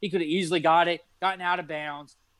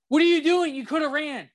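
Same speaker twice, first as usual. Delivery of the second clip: much higher, loud shocked yell; 10 semitones higher, 4 dB louder than usual. Retold or imitated. Fan yelling at the quarterback on TV, high shocked shout. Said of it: "yelling at the T V".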